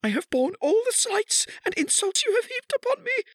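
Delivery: very high, loud, tearful plea; anguished begging. A narrator's reading. Kneeling wife begs her husband, anguished and tearful. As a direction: beg desperately in a high crying voice.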